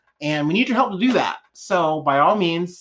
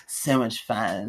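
sultry; 9 semitones lower, 5 dB quieter, much slower than usual. Pretend. Sultry echo.

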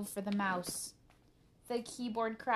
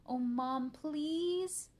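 whiny high plea, stretching out please; begging; 7 semitones higher, 5 dB louder, much slower than usual. A narrator's reading. Arthur begging Mom for the computer, pleading. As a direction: beg like a pestering kid. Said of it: "begged Arthur".

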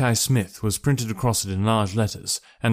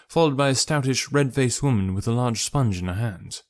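usual speaking voice

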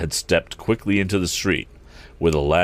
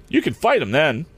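high, eager driver; directing; 8 semitones higher than usual. A narrator's reading. Eradicate coaches Tom to fight, eager. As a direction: urge him on in a high voice. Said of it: "directed the man".

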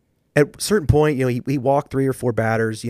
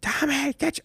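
high, sharp imitated order; dad coach calling out his son, pitched high; mock yelling dad; 4 dB quieter, slower than usual. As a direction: snap at the kid again. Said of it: "So the next game".

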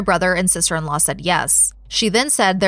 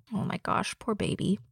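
soft pity; 12 dB quieter than usual. Real, not acted. Reacting to toddler screaming nightly.

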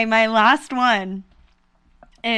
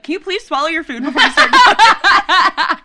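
higher, louder, laughing scold; mock high school bitchiness; snippy demand; 7 semitones higher, 7 dB louder than usual. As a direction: tell the cafeteria kid off through a laugh. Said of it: "I'd literally be like".

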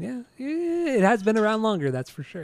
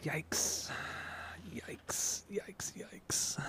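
whispered yikes; 17 dB quieter, much slower than usual. Real, not acted. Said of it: "whispered reaction".

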